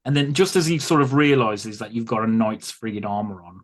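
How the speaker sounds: ordinary delivery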